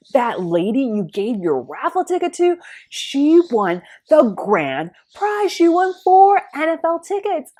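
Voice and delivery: high, loud, gushing, slowing on the big news; excited friend. Retold or imitated. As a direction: shriek the news like it's the best thing ever. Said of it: "my friend excitedly calls up".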